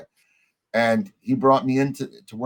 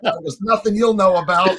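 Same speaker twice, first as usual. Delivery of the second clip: higher and louder cheeky retort; yelling back; 7 semitones higher, 4 dB louder than usual. Retold or imitated. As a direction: toss a sassy answer back at the cops. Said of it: "we yell back".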